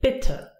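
bitte with a quick open I, as in "bit"; short I. Shown as a demonstration. Teacher showing learners short I, bitte with I short. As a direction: keep the I of bitte brief. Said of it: "And the short version".

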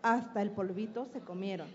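put-on lively storytelling read aloud to children; story narration. Teacher telling the hen fable to an audience, storytelling. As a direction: tell the fable like a teacher at a school event.

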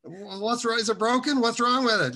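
put-on high, loud puzzled teenager; baffled questions. Young reader confused by a Bible phrase, high and loud. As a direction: sound totally lost about the phrase. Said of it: "And I said".

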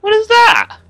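high, loud frightened shriek; spotting a monster, shrieking in fright; startled high shriek. Real, not acted. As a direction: shriek it, suddenly scared. Sees monster.

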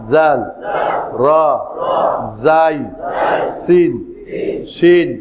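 drawn-out letter names, each set apart; letter-name recitation. Shown as a demonstration. Teacher leading learners through Arabic letters, measured recitation. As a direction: call out each letter for repetition.

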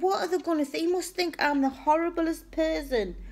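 high-pitched retold dismay; mortified. To her partner about what his family will think, mortified. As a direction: fret it high, replaying your embarrassment. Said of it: "I'm mortified".

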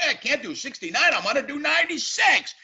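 high brash cartoon American; hammed-up loudmouth American; stupid American. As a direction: sell the crude line like a cartoon. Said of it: "some stupid American".

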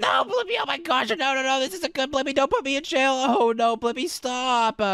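high-pitched mock begging; panicked pleading. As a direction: plead to be spared, ending on a drawn-out stop. Begging not to be jailed.

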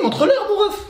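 high-pitched laughing line; laughing high squeal. Acted. High laughing voice mid-intro.

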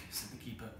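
ordinary delivery, nothing marked

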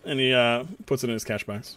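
normal talking voice

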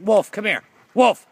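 high, loud commands; calling out. Real, not acted. Owner ordering Wolf to come here, shouting.

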